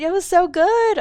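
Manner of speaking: high, loud gush of delight; gushing excitement. Real, not acted. Streamer gushes to chat about the new hand animations. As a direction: squeal it with delight.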